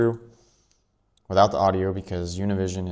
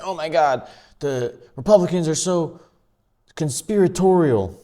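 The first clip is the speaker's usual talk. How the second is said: mock liberal whine; 7 semitones higher, 3 dB louder than usual. Mocking liberals in the media.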